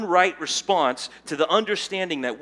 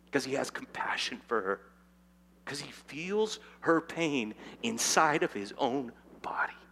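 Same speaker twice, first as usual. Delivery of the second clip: whisper; 5 dB quieter than usual. Real, not acted. Tender whispered aside about Jesus.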